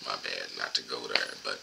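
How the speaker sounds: usual speaking voice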